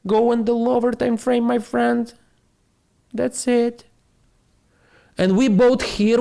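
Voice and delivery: a hint of a high, questioning fan; follower's voice. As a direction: play the eager follower asking, high.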